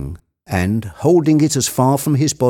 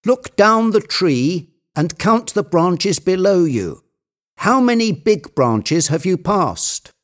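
high, urgent treasure hunter; commanding Legrand; 10 semitones higher than usual. Acted. Legrand directing his climber up the tree, high and insistent. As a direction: call instructions up in a raised pitch. Figure of the story giving orders.

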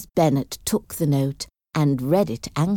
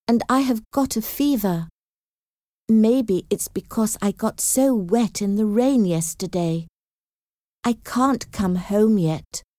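higher-pitched, gentle letter voice; sick Jane's letter; 6 semitones higher than usual. Acted. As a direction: read it out as the ailing daughter, pitched up. Jane's letter read in her voice.

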